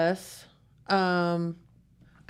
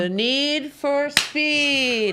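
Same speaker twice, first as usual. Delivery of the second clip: drawn-out quote; 6 semitones higher, much slower than usual. Acted. Finishing Top Gun quote dramatically.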